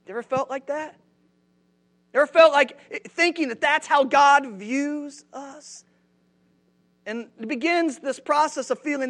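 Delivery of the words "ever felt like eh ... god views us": usual speaking voice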